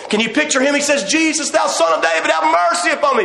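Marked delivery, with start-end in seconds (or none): 1.0-3.2 higher, pleading call for mercy; crying out for mercy. Retold or imitated. Blind beggar to Jesus, a pleading cry. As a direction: call out over the crowd, begging. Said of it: "he cried the more a great deal".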